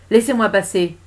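loud, firm order at a steady pitch; forceful and sure of herself; self-assured command. Acted. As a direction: command the crowd to part. Mother commands crowd to part.

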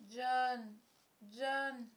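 put-on plaintive little-boy whimper. A narrator's reading. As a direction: whine for your big brother. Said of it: "pleurnichait Michael".

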